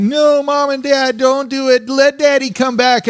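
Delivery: very high, wailing child imitation; mock crying kids. Kids begging parents not to split, mock crying. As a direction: plead like a hammed-up crying child. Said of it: "the kids start crying".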